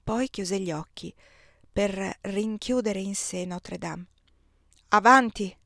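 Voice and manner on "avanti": loud call to drive on; calling out onward. Acted. Man loudly ordering the coachman to drive on.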